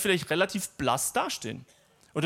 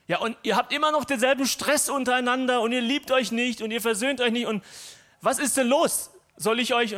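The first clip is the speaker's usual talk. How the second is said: raised, higher voice scolding the church; mild scolding; 7 semitones higher, 3 dB louder than usual. Retold or imitated. Paul scolding the Corinthians, mock exasperated. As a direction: scold them like a disappointed apostle. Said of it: "dann schimpft er ein bisschen".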